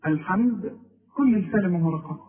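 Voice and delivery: normal talking voice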